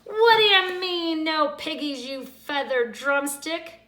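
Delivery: high, loud screech; loud, high witch screech at the hen. A narrator's reading. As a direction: screech at the chicken. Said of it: "Gretch screeched".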